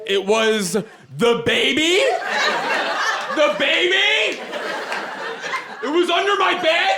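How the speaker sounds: high-pitched frazzled outburst; hysterical disbelief. Retold or imitated. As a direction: stretch it out high, at wits' end. Frazzled self explains, echoing the scream.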